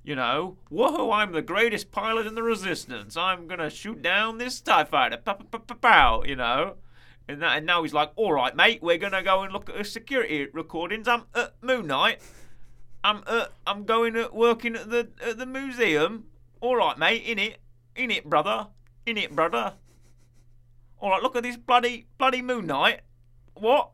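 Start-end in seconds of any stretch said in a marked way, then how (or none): 0.7-6.2 lightly acted gung-ho fighter pilot; higher, playful mock action-hero bravado; Star Wars pilot. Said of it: "Oscar Isaac in, you know, Ex Machina and Star Wars". 8.2-23.9 high, loud hammed-up British bloke; exaggerated British mate-speak with stutters; mock British accent. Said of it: "You can just say words in that accent".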